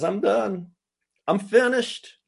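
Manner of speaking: high-pitched, fed-up declaration; exasperated. Acted. Voicing God giving up on Israel.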